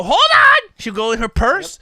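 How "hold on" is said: much higher, louder quick mom call; mock mom. Host imitates mom stopping a spill, high and loud. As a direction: chirp it like a prepared mom.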